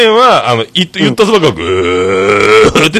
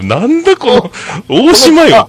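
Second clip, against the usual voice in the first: much higher and louder, incredulous; exasperated retort; 12 semitones higher, 4 dB louder than usual. Retold or imitated. Patient fed up with a cheering nurse, high incredulous retort. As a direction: scoff in disbelief, voice jumping up.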